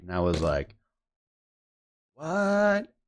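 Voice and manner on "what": long, high-pitched retold "what"; acted-out astonishment; shocked disbelief. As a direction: draw it out high, in stunned disbelief. Retelling his shocked reaction to the message.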